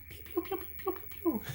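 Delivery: very high, soft little pew pew sounds; laser pews. Pretend. Diner mocking ride lasers shot into soup, high pews. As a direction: toy blaster noises, squeaked out. Imitating laser blasts at dinner.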